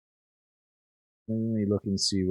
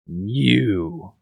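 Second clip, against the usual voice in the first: louder, drawn-out accusing call; captain's yell; 2 semitones higher, 5 dB louder than usual. Acted. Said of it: "he yells".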